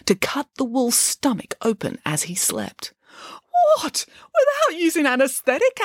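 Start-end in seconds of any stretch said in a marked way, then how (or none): 3.8-5.8 high-pitched shocked question; appalled disbelief. A narrator's reading. Boris interrupts the wolf surgery story, appalled disbelief.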